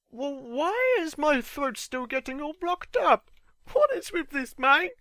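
a whiny complaint about his own throat; exasperated. Retold or imitated. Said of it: "so I'm like".

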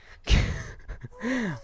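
high nervous burst of laughter; shrill-free nervous giggle; high startled laugh. Real, not acted. Frightened by ghast.

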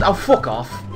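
ordinary talk, nothing marked

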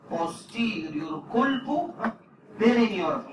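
كل بو rising and held, بيرينيورافي falling; slow careful term. Shown as a demonstration. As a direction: break the term into pieces for dictation. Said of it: "مش عايز اقول له ها؟".

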